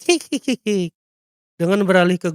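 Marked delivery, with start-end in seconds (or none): 0.0-0.9 mad goblin snicker; wicked hee-hee cackle; crazed giggle. Acted. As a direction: giggle like a gleeful lunatic. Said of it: "penuh seringai gila sambil tertawa".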